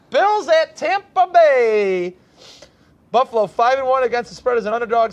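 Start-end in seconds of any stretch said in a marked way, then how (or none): 0.1-2.2 drawn-out, high, loud matchup call; announcer call. Real, not acted. Host introducing Bills at Tampa Bay, drawn-out announcer call. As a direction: stretch it out like a ring announcer.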